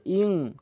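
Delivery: ইং with a nasal ghunna; ikhfa nasal. Shown as a demonstration. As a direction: hum ইং through the nose. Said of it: "ইং এ বলতে হবে".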